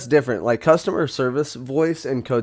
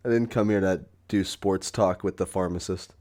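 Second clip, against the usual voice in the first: normal talking voice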